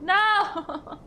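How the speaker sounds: sudden high loud protest; shocked outburst; surprised no. Real, not acted. Playful protest at chat comment.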